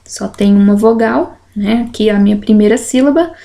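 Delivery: ordinary talk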